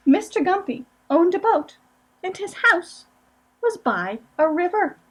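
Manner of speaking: gentle storybook reading aloud; picture-book narration, lightly sing-song; reading to children. Acted. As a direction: read it to a room of small kids.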